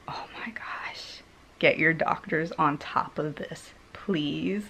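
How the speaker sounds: urgent hushed whisper; nurse whispering. Retold or imitated. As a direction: whisper the warning, alarmed. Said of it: "nurse quoted whispering".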